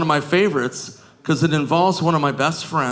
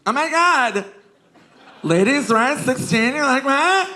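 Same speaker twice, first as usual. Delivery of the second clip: high squealing mock teen girl; 10 semitones higher than usual. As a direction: shriek like a shocked teenage girl. Mocking teen girls shrieking.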